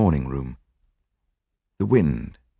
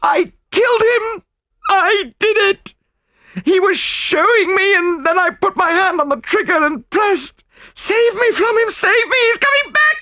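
hysterical; 5 dB louder than usual. A narrator's reading. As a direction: scream and wail in utter terror. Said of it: "she cried hysterically".

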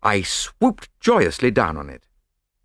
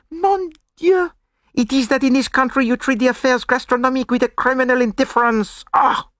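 high, louder, scandalised French detective; outraged gourmet with a French accent; horrified French accent; 3 dB louder than usual. Acted. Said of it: "held up his hands in horror".

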